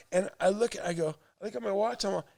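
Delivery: ordinary talk, nothing marked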